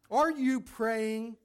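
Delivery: slow, high and loud, pressing the doctor; pointed question. Acted. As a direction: lean into it, drawn out and insistent. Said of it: "she said".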